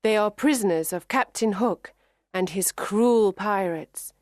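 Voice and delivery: usual speaking voice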